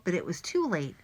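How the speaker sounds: usual speaking voice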